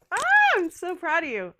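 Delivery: high, loud squeal of pride. Real, not acted. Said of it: "I get really excited".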